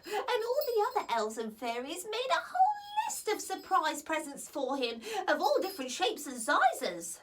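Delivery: usual speaking voice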